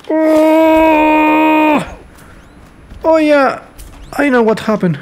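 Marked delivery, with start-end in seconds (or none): none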